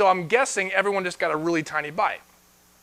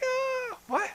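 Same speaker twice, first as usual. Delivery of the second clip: much higher, baffled "Uh, what?"; stunned what; 10 dB quieter than usual. Retold or imitated. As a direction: squeak it out in disbelief. Disciples stunned by the command.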